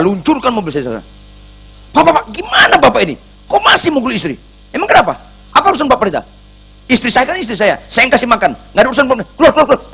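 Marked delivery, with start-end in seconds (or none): 1.9-9.8 high, loud, furious ranting; angry husband yelling at the pastor in a high, loud voice; fierce angry husband. Retold or imitated. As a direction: tell the pastor to get out, full of rage. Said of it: "Marah dia galak".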